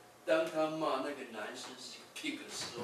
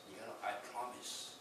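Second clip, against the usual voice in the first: ordinary talk, nothing marked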